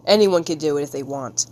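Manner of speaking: usual speaking voice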